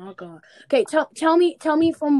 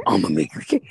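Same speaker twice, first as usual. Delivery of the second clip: mocking the guy with a much lower, heavier voice; deep mock voice; 5 dB louder than usual. Friend mocks the boy's text in a very low voice. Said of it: "He said".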